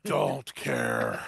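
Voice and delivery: very low, groggy grumble; groggy tea-craver. Acted. Told the tea is still very hot, low groggy grumble. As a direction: grumble it low, past caring.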